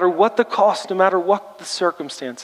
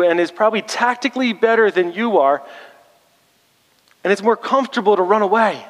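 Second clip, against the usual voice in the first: higher and louder, driving the point home fast at first; fervent preaching; 5 semitones higher, 3 dB louder than usual. Real, not acted. Preacher pressing the congregation about running from comfort, loud and fervent. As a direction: preach it with rising intensity, full force.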